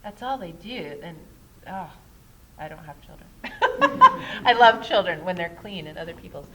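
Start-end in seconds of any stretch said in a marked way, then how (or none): 3.3-4.4 burst of laughter. Real, not acted. Laughs at own joke about children. 4.4-6.5 laughing. Real, not acted. Joking about not having children.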